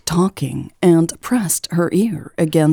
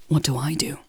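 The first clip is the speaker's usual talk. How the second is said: hushed and much softer; 2 semitones lower, 7 dB quieter, much faster than usual. A narrator's reading. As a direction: ask it in a hushed tone. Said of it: "asked in a hushed tone".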